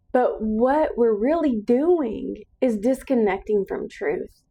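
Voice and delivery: usual speaking voice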